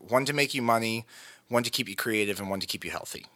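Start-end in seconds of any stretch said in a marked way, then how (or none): none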